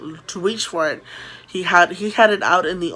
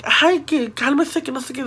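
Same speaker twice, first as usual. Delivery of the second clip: higher, imitated dad hushing; telling to calm down; 6 semitones higher than usual. Dad telling frightened kids to calm down, mildly exasperated. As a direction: lightly mimic a parent settling kids, fading off. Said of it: "telling us to calm down".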